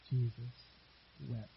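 soft, hushed and unhurried, letting "Jesus wept" sink in; solemn quiet reading. Shown as a demonstration. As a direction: read the verse gently, almost reverently. The shortest verse in the Bible.

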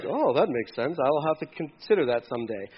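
low, soft, noncommittal musing; mock mild interest. Listener filing an idea away for someday, mock-mild. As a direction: muse it quietly, barely interested. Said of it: "It doesn't mean like".

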